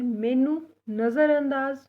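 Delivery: slow, clear मैनू नज़रअंदाज ना करो; teacher modelling Punjabi slowly; slow Punjabi model. Shown as a demonstration. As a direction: dictate it slowly to learners. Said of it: "इसको पंजाबी में हम बोलते हैं".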